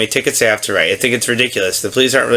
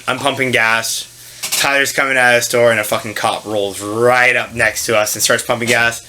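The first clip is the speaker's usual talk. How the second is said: normal talking voice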